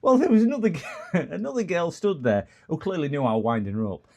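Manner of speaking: high, giggly storytelling; laughing. Real, not acted. Streamer recalls winding up shop assistant, laughing. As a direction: crack up while telling it. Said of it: "That's what's made me laugh".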